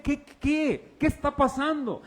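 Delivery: high-pitched, startled questioning; mock-confused outburst, pitched high; acted bewilderment. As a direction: play someone with no idea what's happening. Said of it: "Él no dice".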